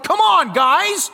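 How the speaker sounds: high, loud pep call to the team; rallying shout. Retold or imitated. Calling teammates to rally, loud high shout. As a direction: fire up the losing team.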